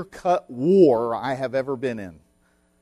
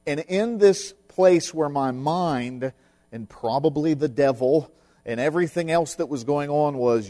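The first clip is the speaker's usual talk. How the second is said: usual speaking voice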